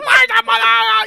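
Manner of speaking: high, loud wordless scream; mock crazed screaming; imitated wild yelling. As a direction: holler like a madman firing into the air. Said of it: "yelling and screaming".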